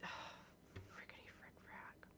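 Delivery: sigh, then whisper frickety frick frack under breath. Real, not acted. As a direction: breathe it out as a hushed aside. Muttering to herself after sigh.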